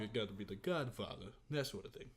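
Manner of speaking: imitation mob boss, low and quiet; deep Godfather. Directors asking for the Godfather, deep mobster voice. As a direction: sink into a soft, deep Godfather voice. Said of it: "we want a really deep kind of".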